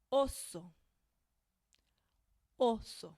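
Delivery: each vowel short and pure; Spanish words demo. Shown as a demonstration. Teacher reads words for learners to repeat. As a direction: read "Cama" "espejo" "oso" slowly and clean. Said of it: "repeat these words with me".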